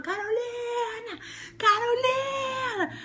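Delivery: long drawn-out far-off call; searching crowd calling Carolina; calling out a name. Retold or imitated. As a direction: call her name across the campsite. Search party calling her name.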